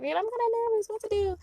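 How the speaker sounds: high, worried client voice; nervous client. Retold or imitated. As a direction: play the jittery client asking for help. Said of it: "if you're like".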